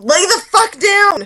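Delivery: high, loud mock yell; retold yell at cat. To her cat at three a.m., loud mock yell. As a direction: snap at the cat to lie down.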